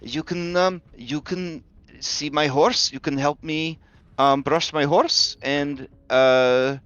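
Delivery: put-on high, coaxing little-girl voice; sweet young girl. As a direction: coax him along gently. Irenia coaxing traumatized boy.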